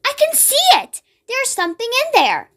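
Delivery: put-on high, eager little-girl cry; excited cry. A narrator's reading. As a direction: shout out, amazed. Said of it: "cried out".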